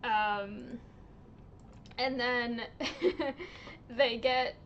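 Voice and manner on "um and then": ordinary delivery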